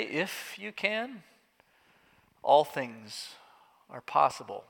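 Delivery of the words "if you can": incredulous echo. Acted. Jesus echoes again.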